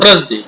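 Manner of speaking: थर्सडे said wrongly as thrus-day; common mispronunciation. Shown as a demonstration. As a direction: imitate the common error in थर्सडे, r straight after th. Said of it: "बहुत सारे लोग ऐसे बोलते हैं". (थर्सडे का गलत उच्चारण, 'थ्रसडे')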